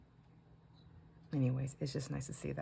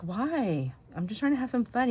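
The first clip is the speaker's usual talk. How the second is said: teasing; 7 semitones higher, 4 dB louder than usual. Retold or imitated. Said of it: "I'm like".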